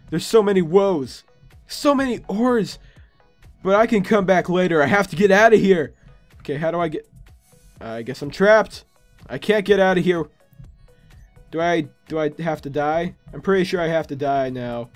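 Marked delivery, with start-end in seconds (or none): none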